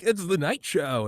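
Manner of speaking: high, slow, dopey host; goofy host voice. Retold or imitated. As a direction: drawl it out like a drugged host. Goofy drugged Fallon voice.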